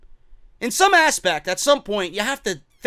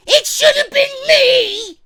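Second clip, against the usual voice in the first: much higher, loud, slow mock sobbing shout; crying wrestler; 7 dB louder, slower than usual. Fan mocks Dolph Ziggler as a crybaby, wailing shout. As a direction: wail it like a crybaby throwing a tantrum. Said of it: "Crybaby bitch".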